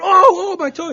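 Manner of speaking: high, loud yelp of pain; boy crying out after dad stomps his foot. Retold or imitated. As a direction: wail over the stomped toe.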